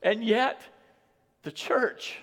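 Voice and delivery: usual speaking voice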